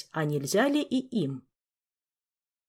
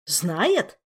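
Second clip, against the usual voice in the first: scoffing. Acted. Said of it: "фыркнул Жак".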